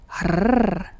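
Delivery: loud "harr" with long tongue-tip trill; emphatic trilled "harr"; rolled R demo. Shown as a demonstration. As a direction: demonstrate a sustained front-of-mouth roll. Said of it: "I can only do a".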